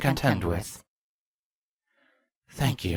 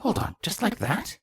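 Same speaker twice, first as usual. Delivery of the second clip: taken aback, voice jumping much higher; genuinely surprised; 8 semitones higher, 4 dB quieter than usual. Acted. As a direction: caught off guard, disbelieving question. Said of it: "genuinely surprised".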